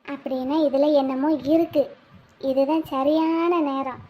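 low, hushed, calculating undertone; scheming aside. Acted. Woman spots her chance in the children's quarrel, scheming aside.

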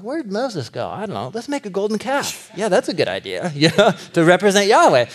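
silly people talking themselves into the golden calf; mocking the Israelites. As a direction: play the clueless Israelites for laughs.

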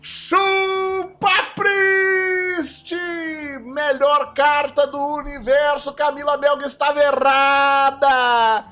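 high, loud, stretched victory shout; triumphant gloating yell. Real, not acted. Streamer gloating at Camila Melga after his card wins, stretched triumphant yell. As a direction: rub the win in her face.

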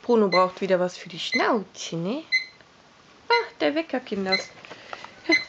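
ordinary talk

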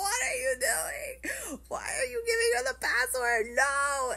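high, loud wailed disbelief; dramatized horror-movie screech; screaming protest. Retold or imitated. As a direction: shriek it in drawn-out, exaggerated horror. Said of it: "I'm screaming at my phone".

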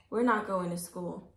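ordinary delivery

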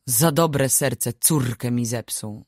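ordinary delivery

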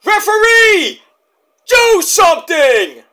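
high, loud, slowed yell at the ref; pleading shout. Pretend. Fan yelling at the referee to do something, loud. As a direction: scream for the referee to step in. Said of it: "I have to say it".

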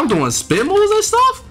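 high, slow, amazed disbelief; surprised. Real, not acted. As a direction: marvel at your own move. Surprised at own game move.

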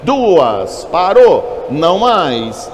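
high, loud hammer call. Real, not acted. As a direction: call the close of bidding out loud. Auctioneer closing the bidding.

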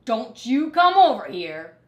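dog warning across the junkyard; barked warning. A narrator's reading. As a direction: bark the warning. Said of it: "barked Dog".

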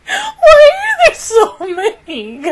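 high, slow laughing whine. Real, not acted. Fan laughing helplessly about too many crushes.

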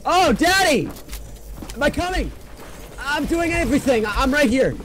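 high, loud frantic yelling mid-fight; frantic excitement. Real, not acted. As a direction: panic and triumph pouring out in a high voice. Frantic firefight, kills an enemy.

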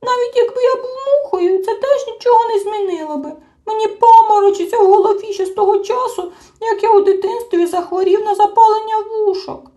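high, loud crying creature voice; a little creature still weeping; tearful whining. A narrator's reading. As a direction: whine through tears about dizziness. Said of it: "Чмих знову заплакав".

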